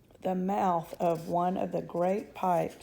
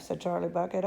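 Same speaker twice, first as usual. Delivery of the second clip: ordinary delivery, nothing marked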